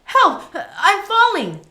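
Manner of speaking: panicked high yell; terrified scream, falling; screaming for help. Acted. Boy falling into the well.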